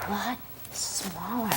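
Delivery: fading very soft and slow; tiny shrinking voice. Acted. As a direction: shrink the voice down to nothing. Frog shrinks, voice shrinks.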